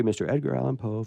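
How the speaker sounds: ordinary talk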